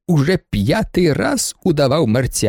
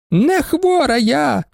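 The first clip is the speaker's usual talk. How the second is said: high, slow indignant shriek; 12 semitones higher, slower than usual. A narrator's reading. Baroness shrieks back at the student, offended. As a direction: screech the denial. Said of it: "заверещала баронеса".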